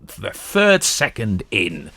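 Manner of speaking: mocking an online commenter's boast; smug commenter. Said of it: "people always say".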